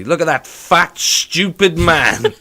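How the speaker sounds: loud, slow jeer through a laugh. Retold or imitated. To a friend about a staring stranger, loud mocking jeer. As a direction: call it out loudly so he hears. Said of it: "really loudly".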